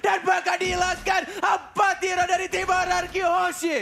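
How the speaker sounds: rising hype play-by-play, high and loud; fighting game announcer. Real, not acted. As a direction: call the teamfight building to a shout. Caster hyping teamfight, rising to shout.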